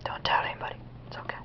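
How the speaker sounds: very soft, unvoiced whisper; hushed secret. Confides a secret to viewers.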